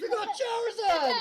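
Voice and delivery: high and laughing; thrilled cheer; excited. Real, not acted. Pulled Charizard.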